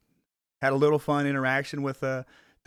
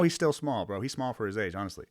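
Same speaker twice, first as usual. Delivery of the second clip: usual speaking voice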